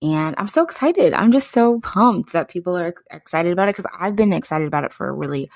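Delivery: normal talking voice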